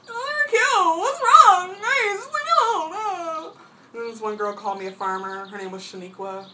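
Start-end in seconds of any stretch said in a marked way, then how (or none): 0.0-3.6 high, loud, slow blubbering whine; fake-sob it like a hurt kid; hammed-up crying protest; mock crying. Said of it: "And I'm like".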